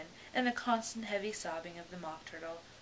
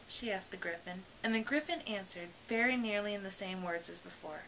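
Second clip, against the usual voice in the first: ordinary delivery, nothing marked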